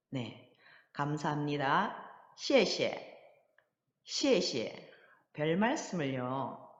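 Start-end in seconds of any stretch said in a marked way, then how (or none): 2.4-4.9 시에시에, first syllable falling sharply from high, second short and light. Shown as a demonstration. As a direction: say "시에시에" as high fall, then a light neutral tone. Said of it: "경성 발음으로 시에시에". (앞 시에는 높게 떨어뜨리고, 뒤 시에는 짧고 가볍게)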